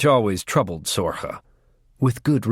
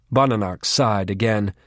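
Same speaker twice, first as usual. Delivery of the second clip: plain reading voice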